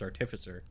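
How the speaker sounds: artificer stressed on the second syllable; ar-TIF-i-ser pronunciation. Shown as a demonstration. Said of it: "it's literally pronounced".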